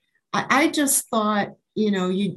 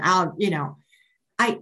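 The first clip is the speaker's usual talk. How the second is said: ordinary talk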